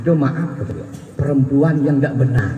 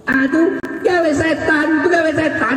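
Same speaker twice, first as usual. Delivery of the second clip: mock high exclamation; 12 semitones higher, slower than usual. Mocking devil's work.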